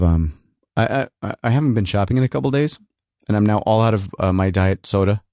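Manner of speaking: ordinary talk, nothing marked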